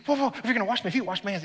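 fast, high rushed whoa; eager Peter. Acted. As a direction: tumble the words out eagerly. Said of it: "Peter's like".

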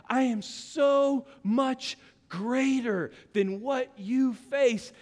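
slow, drawn-out declaration; God declaring. Acted. God telling the believer he is greater, slow. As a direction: stretch each word like a divine promise. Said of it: "That's what he's saying is".

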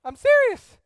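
put-on whiny kid. Kid whine carried on.

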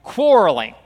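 loud, firm order to quit quarreling; forceful command. Acted. Preacher voicing Paul to quarreling church, loud and firm. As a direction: bark it out like Paul. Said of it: "He says".

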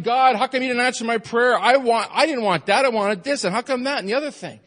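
high, loud, whining prayer; whiny complaint. Shown as a demonstration. Pastor mimicking a complaining prayer to God, loud and high. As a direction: demand like a spoiled child. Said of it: "We're more apt to complain".

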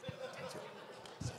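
breathy whispered repeat. Pretend. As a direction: whisper it as a playful aside. Repeated as a joking aside.

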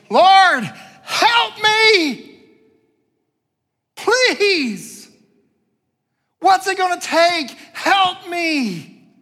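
very high, loud, wailing plea; desperate cry for help. Acted. Woman bows down before Jesus, desperately crying out. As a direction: scream it out, begging to be heard. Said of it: "hear the desperation in her voice".

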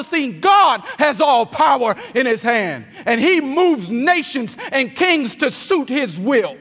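loud, high, impassioned sermon climax; full-force preaching. Acted. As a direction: preach it out, building to a cry.

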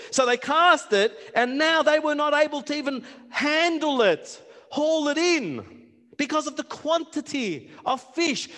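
loud rising sermon climax; full-force preaching. Acted. Preacher to congregation on casting the net, loud fervent preaching.

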